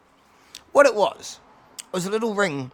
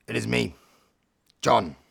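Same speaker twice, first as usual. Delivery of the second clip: low, measured announcement; cat under an alias; 6 semitones lower than usual. Acted. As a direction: announce yourself low, with pauses. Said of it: "he says".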